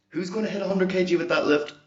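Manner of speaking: hype question. Acted.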